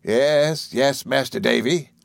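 lightly voiced nursemaid to a child; a hint of a fussing nurse; Peggotty. A narrator's reading. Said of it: "said Peggotty".

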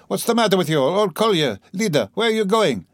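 high-pitched, anxious mother calling out; alarmed questioning, pitch lifted; sudden alarm. A narrator's reading. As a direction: cry out after straying children. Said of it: "she cried suddenly in alarm".

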